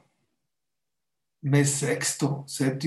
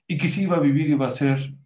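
ordinary delivery, nothing marked

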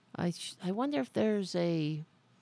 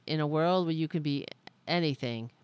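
normal talking voice

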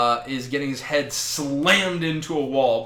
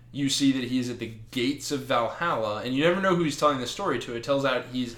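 usual speaking voice

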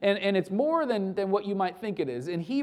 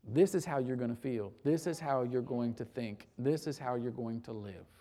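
lower, much softer inner voice; hushed, low words to your own soul; quiet self-talk; 8 semitones lower, 8 dB quieter than usual. Pretend. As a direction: murmur it to yourself. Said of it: "I have to speak to myself".

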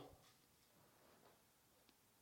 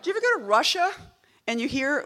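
ordinary talk, nothing marked

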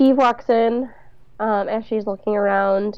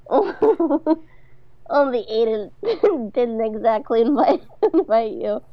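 real giggles breaking up the line; laughing while reading; 2 semitones higher, slower than usual. Real, not acted. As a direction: try to read through helpless laughter. Cracking up while reading dialogue.

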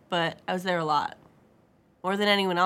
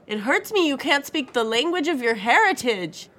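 put-on raised, aggrieved Spanish-accented English; thick Spanish accent; 6 semitones higher, 4 dB louder than usual. A narrator's reading. Father scolding daughter over her heritage, thick Spanish accent. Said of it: "he said in a thick accent".

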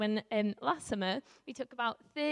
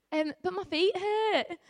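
put-on whiny child; 7 semitones higher, slower than usual. Child whining in maze.

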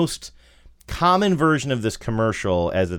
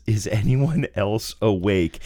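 ordinary talk, nothing marked

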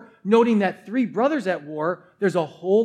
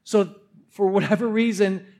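ordinary talk, nothing marked

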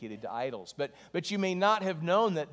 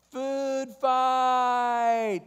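high, drawn-out full-voiced shout; yelled rallying cry; 9 semitones higher, 6 dB louder than usual. Kid to crowded school cafeteria, long loud yell. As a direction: holler it out to start a riot. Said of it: "somebody yells".

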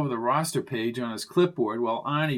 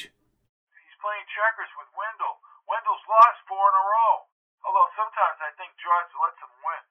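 higher voice at the other end of a phone; higher-pitched, idle telephone chatter; chatty phone character; 5 semitones higher than usual. Acted. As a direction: ramble obliviously over the phone.

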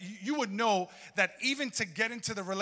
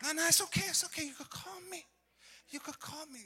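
mock clingy girlfriend; 11 semitones higher than usual. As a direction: coo like a girl waiting up. Mock woman waiting for a call.

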